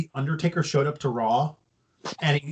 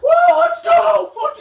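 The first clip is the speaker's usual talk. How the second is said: high, loud mock war cry; hyped-up shout; 9 dB louder than usual. Sting getting ready to go out, hyped-up shout. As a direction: explode into a hammed-up, drawn-out hype yell. Acted hype before going out.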